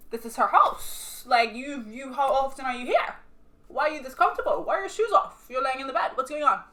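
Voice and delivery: loud, incredulous questioning; indignant confrontation. Retold or imitated. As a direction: fire off outraged questions, retelling it. Acts out confronting the cheating boyfriend.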